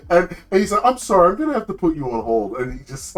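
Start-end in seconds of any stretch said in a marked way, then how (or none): 0.8-2.6 quick, high fake apology; snarky turtle. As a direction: rattle off a smug operator's brush-off. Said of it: "He has this, like, really snarky voice".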